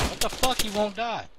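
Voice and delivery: high, loud exasperated outburst; frustrated disbelief. Real, not acted. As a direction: yell in shocked frustration. Enemy keeps surviving.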